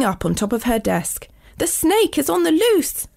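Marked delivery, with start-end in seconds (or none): 1.6-3.1 high-pitched storybook panic; acted screamed alarm. A narrator's reading. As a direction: sound the alarm in a high, contained cry. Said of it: "screamed Samantha".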